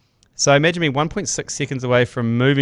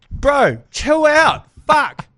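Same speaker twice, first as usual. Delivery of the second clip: exasperated telling off; 12 semitones higher, 4 dB louder, much slower than usual. Retold or imitated. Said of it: "And I was like".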